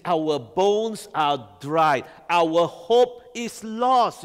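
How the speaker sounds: "Our bones are dried. Our hope is lost." drawn out very slowly; slow lament. Acted. Preacher reading the exiles' lament, slow and weighty. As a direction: drag each word out like a grieving exile. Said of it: "And he says".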